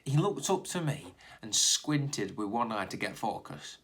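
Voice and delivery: plain reading voice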